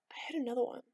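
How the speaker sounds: soft hushed aside; quiet aside to viewers; whispered. Real, not acted. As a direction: half-whisper it.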